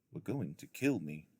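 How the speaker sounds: usual speaking voice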